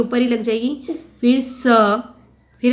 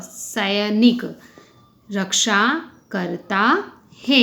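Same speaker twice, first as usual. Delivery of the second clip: each sound of सैनिक drawn out slowly, sa-i-nik; slow dictation; slower than usual. Shown as a demonstration. Teacher sounding out सैनिक slowly for young pupils. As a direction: spell out सैनिक slowly for the class. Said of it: "तो स पे आई से ऐनक की मात्रा".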